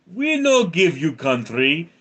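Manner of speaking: Ukraine's defiant reply. Acted. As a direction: refuse in a hammed-up Eastern European voice. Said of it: "And Ukraine says".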